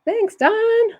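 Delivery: high, loud, beaming thank-you; bright thanks. Real, not acted. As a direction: throw a cheery thanks her way. Cheerful thanks to colleague.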